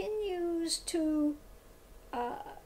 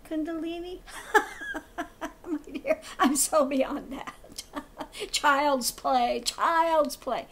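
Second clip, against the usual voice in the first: laughing, loud, theatrical scoffing; mock dismissive guru; 5 dB louder than usual. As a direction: laugh it off grandly, imitating a condescending guru. Mocks gurus dismissing Kundalini with laughter.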